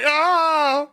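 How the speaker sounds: high, whiny fake wail; hammed-up sobbing lament; mock crying. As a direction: bawl theatrically, stretching out the ahs. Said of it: "have a good old cry".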